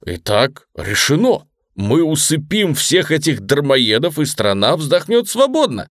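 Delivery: high, elated proclamation; thrilled, ringing announcement; delighted. A narrator's reading. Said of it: "Ружера пришёл в восторг".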